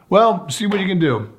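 high, loud, pushy retort; Ted pressing the official, high and loud; Ted's challenge. Retold or imitated. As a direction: fire back, pitch up and loud. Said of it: "Ted says to this guy".

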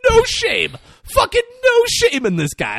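high, loud, real outburst; amazed shouting. Real, not acted. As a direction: cry out in delighted disbelief. Teller marvels at the character's line.

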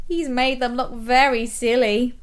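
usual speaking voice

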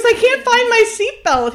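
high, loud, hammed-up panicked squeal; mock panic. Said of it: "She goes".

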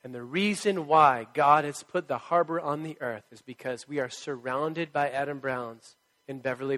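ordinary talk

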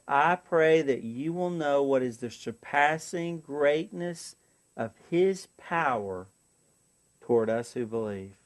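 slow, soft, reverent reading of the verse; slow hushed scripture prayer; hushed prayerful reading. Shown as a demonstration. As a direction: read it slowly and softly, like a prayer. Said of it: "I'm praying right now".

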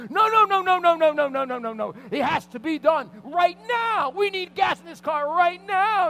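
very high shout, slowing to drawn-out words; rapid-fire noes into a stretched, yelled demand; freaking out. Retold or imitated. Husband refusing to wait until tomorrow, freaking out. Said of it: "I was freaking out".

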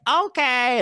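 very high, loud mock mom; mom giving in. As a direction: play the mom agreeing in a high voice. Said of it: "And she'd be like".

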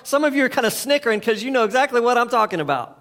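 ordinary talk, nothing marked

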